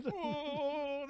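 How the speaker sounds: mock ooh. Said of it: "He's like".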